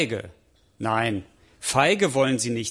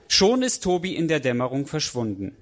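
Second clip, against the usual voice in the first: usual speaking voice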